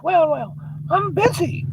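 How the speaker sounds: put-on very high old-lady falsetto; busy old lady. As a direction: brush the visitor off in a high voice. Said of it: "She goes".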